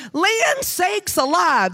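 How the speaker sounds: high, drawn-out mock scolding; exasperated mother. Speaker imitates her mother catching her in trouble, exasperated. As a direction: exclaim it slowly in a high mom voice.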